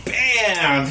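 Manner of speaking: high, drawn-out "bam"; punchy mock crash; impact exclamation. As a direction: stretch it out like a body slamming in. Imitates her slamming into him.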